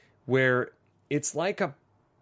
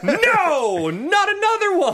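very high, loud wail of protest; stretched, exaggerated shout of refusal; retold outcry of refusal; 7 dB louder, slower than usual. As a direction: cry out in hammed-up dismay. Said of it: "I literally went".

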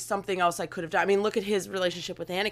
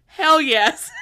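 "Hell yes" loud, high, through a laugh; 9 semitones higher, 6 dB louder than usual. Acted. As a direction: laugh out the punchline. Laughing punchline of letter.

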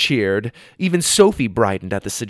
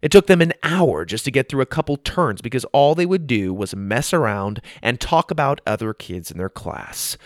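ordinary delivery

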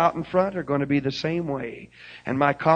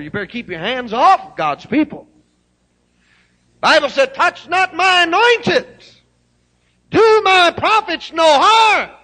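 loud, high, slow thundering scripture; full-force preaching; 13 semitones higher, 13 dB louder than usual. Acted. Preaching climax.

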